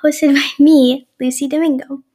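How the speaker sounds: bright podcast opener; podcast intro. Acted. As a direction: open the show like a host.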